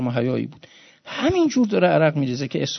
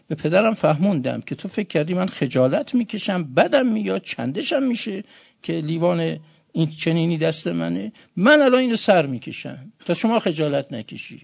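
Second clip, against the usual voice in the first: normal talking voice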